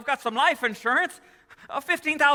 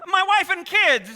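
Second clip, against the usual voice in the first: higher, louder, slow begging; desperate pleading; 6 semitones higher, 4 dB louder, slower than usual. Acted. Man begging the banker for help, desperate. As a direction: plead for your family. George begs Potter.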